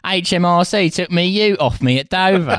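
high, badly faked British-Australian voice; botched British-Australian accent. Joining the Australian British patriot bit, botched accent. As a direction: imitate co-host's British accent drifting antipodean. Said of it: "I did neither a British nor an Australian accent".

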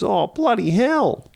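high, slow mock Aussie exclamation; exaggerated Australian accent. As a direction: ham up an Aussie drawl. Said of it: "Australian Bones is a show".

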